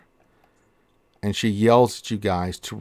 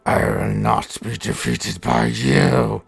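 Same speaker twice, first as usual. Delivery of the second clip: slow menacing creature voice; 3 dB louder, slower than usual. Acted. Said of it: "It's like".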